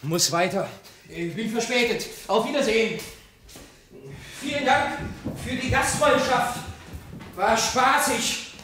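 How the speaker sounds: frantic, fluttering goodbye; flustered hurry. Acted. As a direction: rush out the door, late and rattled. Hurrying off late in the morning.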